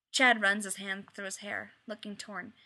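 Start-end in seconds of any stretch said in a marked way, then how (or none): none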